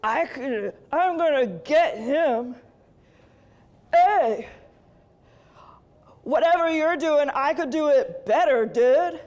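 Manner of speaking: loud swaggering devil bro; cocky devil calling to his bros. Retold or imitated. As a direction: brag like a cocky dude devil. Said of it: "and he goes".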